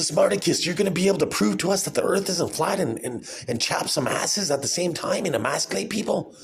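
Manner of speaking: high, gushing fake interviewer; mock-eager questioner. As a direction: pitch it up like an awed fan. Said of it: "playing different roles here".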